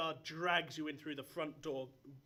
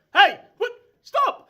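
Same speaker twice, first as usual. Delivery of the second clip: loud startled shout; guard bellowing stop; 24 dB louder, slower than usual. Acted. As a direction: yell at the intruder to stop. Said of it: "the two guards ... shout".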